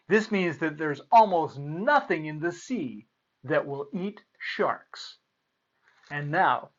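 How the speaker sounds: ordinary delivery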